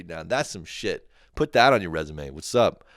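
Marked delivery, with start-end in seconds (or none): none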